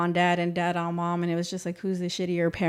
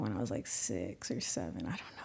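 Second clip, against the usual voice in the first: much softer and lower, trailing into a whisper; fading to whisper; 12 semitones lower, 14 dB quieter than usual. Real, not acted. Guest recalling age at the arrest, fading to whisper. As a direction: let it die away to a whisper. Painful childhood memory.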